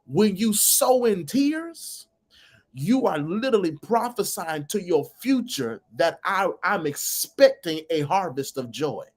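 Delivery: high, impassioned preaching, slow at the start. Acted. Preacher lifting the live audience, fervent preaching.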